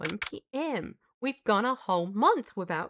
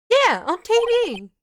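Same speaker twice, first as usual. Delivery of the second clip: sarcastic yeah; 10 semitones higher, 6 dB louder than usual. Acted. Nick's sarcastic retort.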